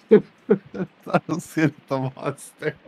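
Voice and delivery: slow, broken up by laughter; laughing excuse. Real, not acted. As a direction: protest innocence through laughter. Laughs after hitting a teammate.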